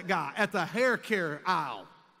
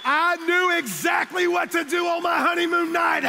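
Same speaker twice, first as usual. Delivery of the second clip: much higher and louder victory punchline; triumphant shout; 10 semitones higher, 9 dB louder than usual. Real, not acted. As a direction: yell it out as the big payoff.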